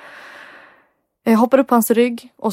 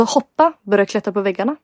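ordinary delivery

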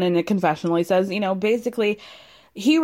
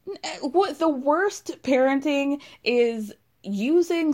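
high, halting, upset outrage; about to cry; 5 semitones higher than usual. Real, not acted. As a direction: stammer in disbelief, close to tears. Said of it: "I was, like, really about to cry".

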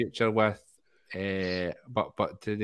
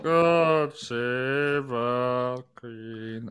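drawn-out; much slower than usual. Retold or imitated. Ending the quoted skit.

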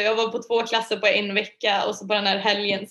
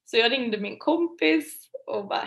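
normal talking voice

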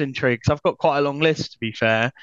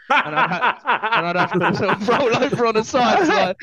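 chuckling through the words; laughing while telling it; 5 semitones higher than usual. Real, not acted. Laughing while describing bath.

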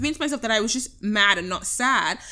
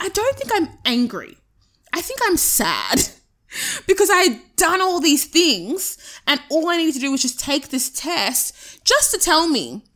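pitched well up, a touch tearful, reliving the moment; retold upset self; 5 semitones higher than usual. To her listener, recalling the cancelled test, higher, lightly upset. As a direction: replay your own shaken confusion in a higher voice.

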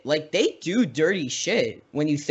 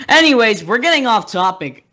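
loud mock scold; 8 semitones higher, 8 dB louder than usual. Jokingly cuts off tangent.

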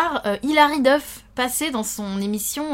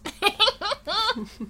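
laughing hard; 11 semitones higher, 4 dB quieter than usual. Real, not acted. Podcast hosts joking.

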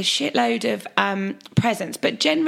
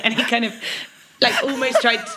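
ordinary talk, nothing marked

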